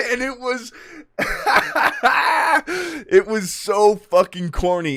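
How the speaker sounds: high-pitched, cracking up mid-sentence; helpless giggling commentary; laughing. Real, not acted. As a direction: struggle to talk through real laughter. Cracking up at own impression.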